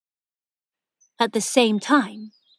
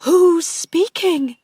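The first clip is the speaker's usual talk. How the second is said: higher, louder, slow and scared; frightened aunt calling out to the voice; frightened question; 8 semitones higher, 8 dB louder, slower than usual. A narrator's reading. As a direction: ask it in sudden fear. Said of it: "giving a little cry".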